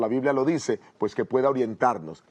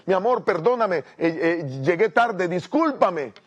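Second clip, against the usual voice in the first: higher, urgent apologetic plea; pleading apology; 6 semitones higher, 3 dB louder than usual. Acted. Late husband to upset wife at the door, apologetic plea. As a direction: beg forgiveness at the door.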